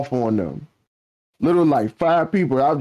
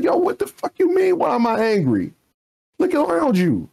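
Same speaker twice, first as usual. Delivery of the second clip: much higher, heated retort, voice jumping up; retold angry outburst; 13 semitones higher than usual. Telling coworkers who asked why he's angry, heated and high. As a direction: fire it back at them, incredulous and worked up. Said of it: "why are you so angry".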